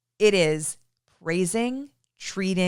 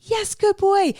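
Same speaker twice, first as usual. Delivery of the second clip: put-on much higher, slow and gushing good girl; excited praise; 13 semitones higher, 4 dB louder, much slower than usual. Shown as a demonstration. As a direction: praise the dog like it's the best thing ever. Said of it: "So we're saying".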